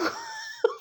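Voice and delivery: high helpless burst of giggles; laughing fit. Real, not acted. Vlogger cracks up after mispronouncing soup, giggling. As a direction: crack up at her own slip. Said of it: "इतना हंसी हूँ ना".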